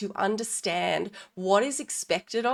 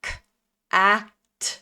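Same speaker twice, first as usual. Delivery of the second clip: each sound apart, then louder; separated sounds; 5 dB louder than usual. Shown as a demonstration. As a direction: pause between every sound. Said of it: "put all of those sounds together".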